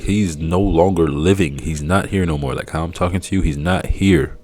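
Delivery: normal talking voice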